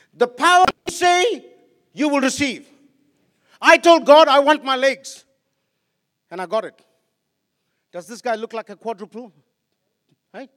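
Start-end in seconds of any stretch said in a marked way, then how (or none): 0.1-5.2 higher, loud and slow, building to a cry; preaching at full force. Acted. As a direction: proclaim it as a triumphant testimony. Preaching his testimony.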